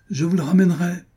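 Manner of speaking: high, loud shout back; calling out. Acted. Doctor leaving, calling back to the duchess, loud. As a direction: call it over your shoulder as you leave. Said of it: "cria-t-il".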